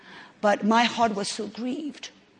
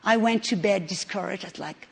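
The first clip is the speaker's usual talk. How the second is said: normal talking voice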